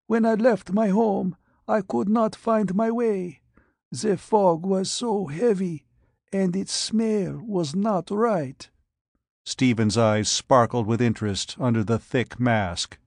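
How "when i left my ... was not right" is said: put-on high, frail old man's voice; withered dying patient. Blind radiation patient answers the doctor, high and frail. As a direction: rasp it out like a man aged beyond his years.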